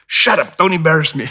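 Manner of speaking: mock mom hissing at her kid; South African mom scolding. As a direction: contrast to the gentle American mom. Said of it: "And South African".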